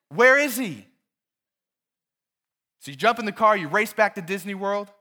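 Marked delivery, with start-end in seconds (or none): 0.1-0.9 panicked. Retold or imitated. Panicked parent searching for son.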